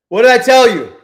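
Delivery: loud, high shout of vindication; real irritated outburst; frustrated yell. Real, not acted. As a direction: throw the question at the screen. Trade going against him.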